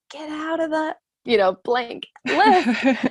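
high, slow, exasperated order to the staring man; husband snapping. Retold or imitated. Husband in the lift orders the staring stranger out, exasperated and slow. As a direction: drag out the order, high and fed up. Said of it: "my husband is like".

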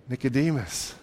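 said low and soft, almost to himself; hushed name. Shown as a demonstration. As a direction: let the name Nicodemus drop into a hush. Name repeated, hushed then plain.

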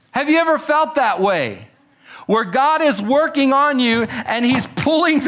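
ordinary delivery

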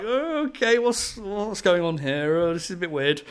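high, slowed bewildered surprise, replaying the moment. To his co-host, replaying his confusion when [name] appeared: high, slowed mock bewilderment. Retelling own reaction meeting [name].

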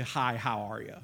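low, soft throwaway greeting; perfunctory, low-pitched mumble of politeness; rote greeting. Shown as a demonstration. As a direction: say it automatically, meaning nothing. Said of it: "we say it so much it doesn't".

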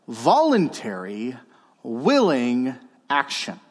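slow, measured emphasis on each word; slow dictation. Real, not acted. Preacher dictating to note-takers, slowly and deliberately. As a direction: spell out the key phrase word by word.

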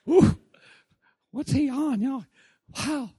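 giddy, high, eager voice; excited. Retold or imitated. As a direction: bubble over with childlike excitement. Excited pre-meeting anticipation, pitch up.

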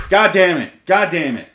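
sigh, then loud, high, frustrated curse; angry cursing. Real, not acted. Host interrupted while recording the episode, angrily cursing. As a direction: let it burst out twice in anger. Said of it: "I'm fucking angry right now".

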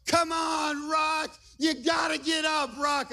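high-pitched shouted urging; imitated fan yell. Son cheering on a pinned wrestler, imitated high yell. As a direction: yell like a kid willing his hero up. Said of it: "Luke yells out".

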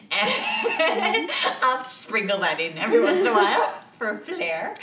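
loud laughing aside. Real, not acted. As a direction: giggle through the words. Laughs while saying.

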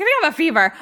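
mocking her own sweating, giggling; laughing self-mockery. Mom mocking herself, laughing.

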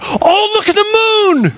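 high, slow gasp of delight at the moon; excited wonder. Retold or imitated. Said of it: "she's like".

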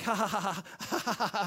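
imitated hollow laughing; repeated put-on ha-ha laugh; mock laughter. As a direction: fake a helpless laugh at bad luck. Mimics nervous laughter at disaster.

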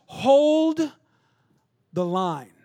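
high, loud battle cry on Hold; screamed command. Retold or imitated. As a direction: scream it to rally the fleeing army. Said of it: "He screams this sentence".

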